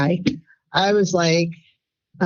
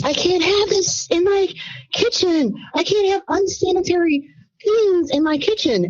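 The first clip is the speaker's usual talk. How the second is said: much higher, shrieking mock scream; screaming head chef; 10 semitones higher than usual. Head chef Tyra screaming about her kitchen, high mock shriek. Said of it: "suddenly she screamed".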